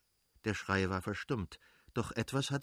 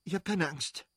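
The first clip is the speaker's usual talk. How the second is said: higher, pressed insisting; hurried reassurance; 7 semitones higher than usual. Acted. Kitty insists to Levin she is not afraid, higher and pressed. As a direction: insist you are fine while fear rises. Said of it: "stieß sie rasch hervor".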